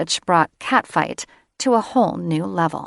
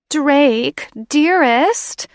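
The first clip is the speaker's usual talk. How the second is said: much higher, sugary coo; 9 semitones higher, 7 dB louder, much slower than usual. Acted. Catherine stroking Drake's cheek, higher cooing. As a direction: sweet-talk him. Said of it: "Catherine cooed".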